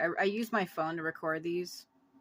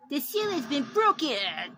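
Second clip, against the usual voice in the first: dramatic voice; 8 semitones higher, 5 dB louder than usual. Pretend. Dramatic announcement in game.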